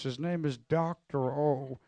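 a hint of the slow-talking professor; professor impression. Pastor imitating his Bible college professor, slow and lightly voiced. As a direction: lightly mimic Doctor O's slow speech. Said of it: "he talks like this".

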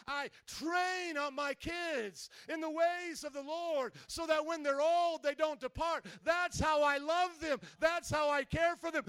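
high, loud, drawn-out preacher's cry; impassioned pulpit proclamation; full-force preaching. Real, not acted. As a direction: preach it with rising urgency, stretching key words.

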